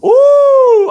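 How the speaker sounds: high, loud delighted ooh; a squeal of anticipation; excited ooh. Real, not acted. Co-host reacting to the next award category with an excited squeal.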